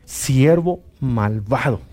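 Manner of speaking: slow, stern rebuke from the king, lightly voiced. A king scolds his wicked servant, slow and stern. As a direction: drag out the words like a disappointed king. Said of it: "le dice".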